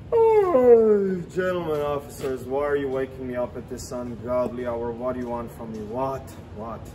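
a hint of a sleepy drunk woken at night; vampire host feigning sleepy drunkenness to the police; mock tired drunk. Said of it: "acting he's very tired, drunk, and sleepy".

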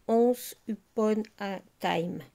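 "Once Upon a Time" in careful French-accented English, "Once" as a nasal "onss"; slow, unsure French-accent English title. Real, not acted. Crafter reading an English paper name to viewers, French-accented and slow. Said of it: "je sais pas si mon accent, il est bon".

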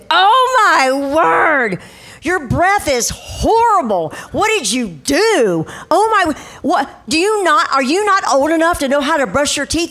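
over-the-top sarcastic telling-off; sarcastic scolding. Retold or imitated. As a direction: scold with stretched, incredulous disgust. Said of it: "Guess who became sarcastic?".

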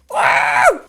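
loud low wow of amazement; amazed shout. Real, not acted. Gamer sees the new mob, loud amazed wow. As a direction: gasp it out in awe.

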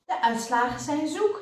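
a nurse's worried whine; wailing. A narrator's reading. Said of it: "jammerde de zuster".

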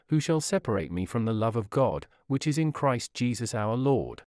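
normal talking voice

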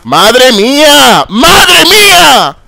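very slow, high, loud wail; long exasperated groaning cry; drawn-out exasperation. Real, not acted. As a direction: stretch it out in disbelief. Exasperated at the campers.

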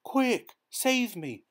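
high, loud urgent plea; urgent cry. A narrator's reading. Upside-down boy begs the sticker, high and urgent. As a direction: cry out for help fast. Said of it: "our hero cried".

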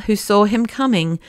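usual speaking voice

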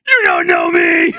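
high, loud, drawn-out tearful shriek; anguished scream. Real, not acted. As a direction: scream it at them, voice high and strained. Screams the same retort at chat.

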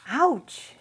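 sharp, high, loud yelp of pain; pained soldier's shout. Acted. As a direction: yell it out as the wrist is cut. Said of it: "he shouted".

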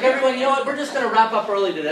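announcing to class. Acted. Acts announcing early dismissal to class.

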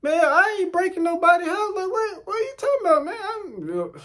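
very high-pitched, near-crying protest; panicked squeal. Retold or imitated. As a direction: squeal your innocence as the voice shoots up. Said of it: "it started going".

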